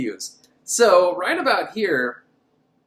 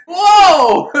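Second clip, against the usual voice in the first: very high, loud whooped "whoa"; hammed-up yelp of shock; mock shocked whoa; 14 dB louder than usual. Reacting to the imagined villain.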